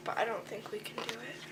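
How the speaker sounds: fast whisper; exhausted explorer whispers they can't go on; despairing whisper. Acted. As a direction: breathe out the doubt.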